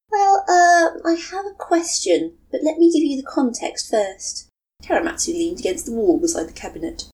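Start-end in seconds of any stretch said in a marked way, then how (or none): 0.1-4.5 put-on young woman voice. Matsuri's voice on the phone.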